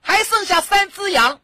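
mother giving the answer. Retold or imitated. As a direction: answer like a mum pleased with her trick. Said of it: "有一个妈妈啊". (妈妈得意地揭晓答案，声音提高)

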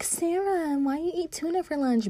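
high-pitched mean-girl imitation; mocking classmate. Mocking classmate's question about lunch.

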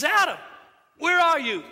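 loud, high call of God searching. Acted. God calling Adam from the garden, loud high call.

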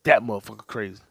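ordinary delivery

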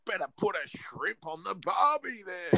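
high, slow mock Australian accent; a bad movie Australian accent, slowly. Friends quote Jim Carrey's bad Australian accent. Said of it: "what does Jim Carrey say?".

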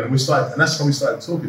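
usual speaking voice

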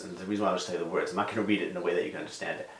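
rushed flat mumble; fast quiet read. Shown as a demonstration. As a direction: rattle it off quickly and softly. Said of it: "I read it in that deadpan voice".

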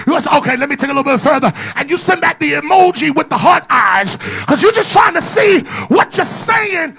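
loud, high, driving preacher shout; shouting preacher in full flow; full-force preaching. Acted. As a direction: hammer it home to the crowd.

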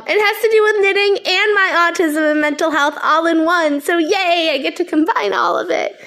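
chatting while high on weed; stoned. Real, not acted. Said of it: "I am higher than I thought".

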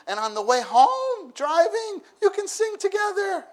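high, loud and bright-eyed, sing-song lift; excited urging. Real, not acted. Preacher urging parents to sing with children, excited. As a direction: sell the joy of singing together.

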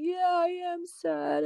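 put-on high, slow little-boy voice, lightly sulky; sad little boy. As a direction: voice her son, small and sad.

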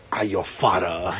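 low, soft laughing Vader line; a kid's hint of Darth Vader; lightly voiced Vader. Son raising his fist at dad, lightly low Vader voice. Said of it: "he holds his fist in the air and he goes".

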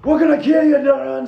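high, loud villain war cry; hammed-up enemy battle yell; menacing enemy trooper. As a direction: shout a threat across the battlefield. Game master voices attacking troopers.

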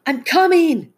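high, loud urgent call. A narrator's reading. Dad running to his daughter, calling out. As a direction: call to her from a distance. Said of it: "her dad called".

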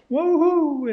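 high ironic cheer; mock woohoo. Lecturer mocking a pricey old disk with a high woohoo.

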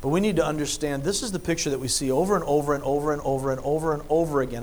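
fast, breathless string of repeats; racing through the list, slightly soft; rapid run-on. Real, not acted. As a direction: rattle off the overs quickly, piling them up until the air runs out. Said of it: "but I'm out of breath".

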